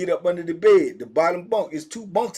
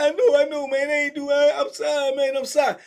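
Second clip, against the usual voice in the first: scared apology; 8 semitones higher than usual. Retold or imitated. Caught man begging.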